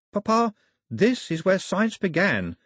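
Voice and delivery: put-on high girl's voice, lightly urgent; urgent daughter. A narrator's reading. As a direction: plead the case to Papa. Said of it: "Daphne said quickly".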